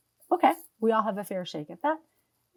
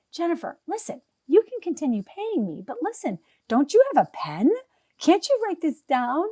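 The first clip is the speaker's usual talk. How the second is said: raised, higher voice, pleading with her to write it down; exasperated retelling; 7 semitones higher, 6 dB louder than usual. Retold or imitated. Coach to client who keeps asking: exasperated. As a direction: urge her, half scolding, to take notes.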